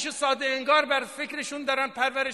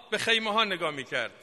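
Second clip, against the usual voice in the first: usual speaking voice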